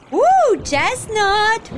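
high, loud singsong excitement; delighted squeal. Real, not acted. Player spotting treasure, high delighted squeal. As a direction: coo loudly with delight. Spots a chest in game.

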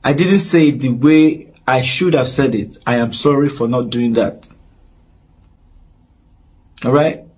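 normal talking voice

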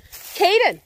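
impatient holler at the dog; calling out. Real, not acted. Calling dog, getting upset.

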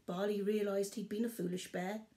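ordinary delivery

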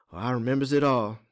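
ordinary delivery

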